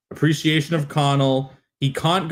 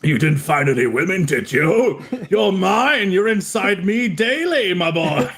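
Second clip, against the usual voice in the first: hammed-up clingy lover; tree as a jealous partner, lightly voiced; mock jealous tree; 6 semitones higher than usual. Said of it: "is the tree a jealous lover".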